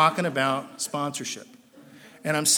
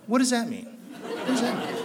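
laughing disbelief; 7 semitones higher than usual. Real, not acted. Mocking a slogan he hates.

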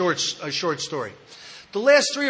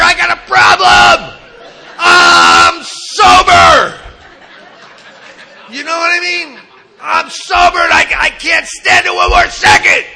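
loud, high frantic yelling; desperate shout; 10 semitones higher, 13 dB louder than usual. Retold or imitated. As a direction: yell it like you can't wait one more second. Retelling his desperate inner rant.

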